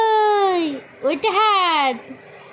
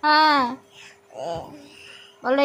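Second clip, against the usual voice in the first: ordinary talk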